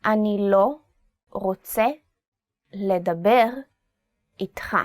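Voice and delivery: word by word, spaced out; slow emphatic. Shown as a demonstration. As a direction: spell it out slowly. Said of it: "I don't wanna talk to you".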